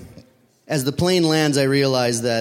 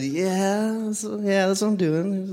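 higher-pitched, drawn-out mock agreement; bluffing agreement; 6 semitones higher, slower than usual. Traveller bluffing the customs man, mock agreement. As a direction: play along in a raised voice.

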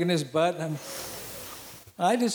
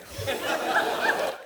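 wheezy burst of laughter; cracking up at himself; high breathy laugh; 9 semitones higher than usual. Real, not acted. Laughs at his own story.

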